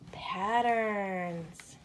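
usual speaking voice

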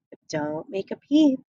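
ordinary delivery

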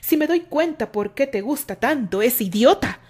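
loud jealous accusation; jealous shout. A narrator's reading. Terry yells at Candy about the other man, loud and jealous. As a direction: throw the insult at her, loud. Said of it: "le gritó".

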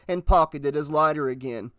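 ordinary delivery

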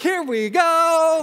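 high, loud, drawn-out call; mock rallying cry. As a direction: sing out as if leading a parade. Acts leading the awkward parade out.

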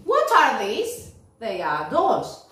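teacher modelling a dialogue, slow and clear; slow question and answer. Shown as a demonstration. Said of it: "me voy a preguntar y me voy a contestar".

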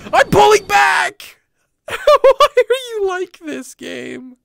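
high, loud helpless yelling; exasperated shout. Real, not acted. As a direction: wail at the game in frustration. Yelling at unresponsive game controls.

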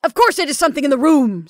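fast, high, loud rebuke; fury. Acted. Caroline turns on Rebecca, furious. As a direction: round on her furiously. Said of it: "turned upon her with a sort of fury".

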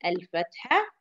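normal talking voice

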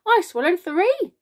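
very high, loud outburst; boastful cry. A narrator's reading. Centipede outdoing the earthworm, crying out. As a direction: cry out to top the others. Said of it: "the centipede cried".

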